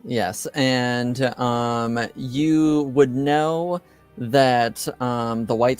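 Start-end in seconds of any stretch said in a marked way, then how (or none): none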